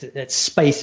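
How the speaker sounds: ordinary delivery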